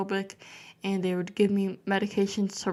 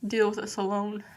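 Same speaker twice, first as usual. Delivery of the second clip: ordinary delivery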